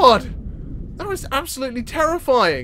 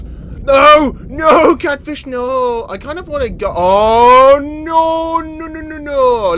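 panicked no; 4 semitones higher, 7 dB louder, slower than usual. Real, not acted. Catfish attacks.

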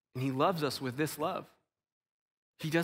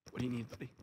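much softer, gentle ask; soft question to child; 5 semitones lower, 11 dB quieter, much faster than usual. Retold or imitated. Father kneels to ask his toddler son, soft. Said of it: "he got down on his knees".

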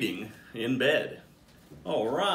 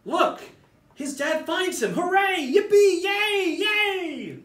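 high, much louder squealing cheers; loud cheering; 6 dB louder, slower than usual. Acted. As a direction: cheer like the happy ending is the best news ever. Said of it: "Their monkeys are loud".

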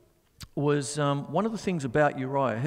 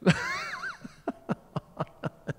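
high burst of laughter; 4 dB quieter than usual. Real, not acted. Laughing at the bandmate.

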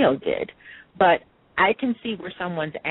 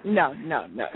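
usual speaking voice

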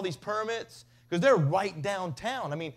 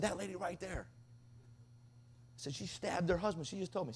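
hushed telling; 2 semitones lower, 14 dB quieter than usual. Retold or imitated.

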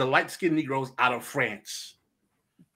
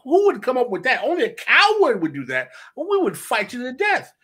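defiant Christophe; 9 semitones higher, 4 dB louder than usual. Retold or imitated. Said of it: "He said".

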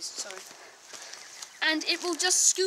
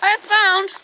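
excited; 5 semitones higher, 8 dB louder than usual. Pretend. Excited find.

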